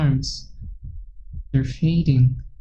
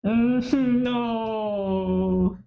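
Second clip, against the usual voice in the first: delirious faint laugh; 6 semitones higher, 3 dB quieter than usual. Acted. Yuri delirious as vision fades.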